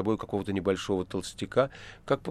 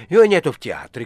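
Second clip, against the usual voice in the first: loud, raised exclamation; shouted; 6 semitones higher, 10 dB louder than usual. A narrator's reading. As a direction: snap it loudly into the phone. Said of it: "крикнул было Варенуха". (громкий раздражённый выкрик)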